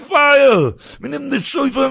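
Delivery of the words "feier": high, louder cry of fire; fire shout. Retold or imitated. Watchman shouts the alarm to the town. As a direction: sound the alarm with your voice.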